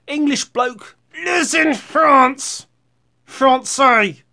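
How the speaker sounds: high, loud put-on voice; over-the-top exclaiming. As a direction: crow it slowly and theatrically. Clowning on France in put-on voice.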